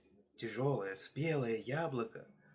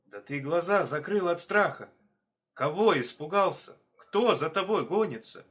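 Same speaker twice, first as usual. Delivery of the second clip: agitated questioning; 4 semitones higher, 6 dB louder than usual. Acted. Hares questioning the fleeing hare.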